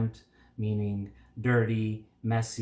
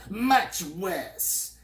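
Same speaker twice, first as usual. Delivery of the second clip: longing; 8 semitones higher than usual. A narrator's reading. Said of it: "he said longingly".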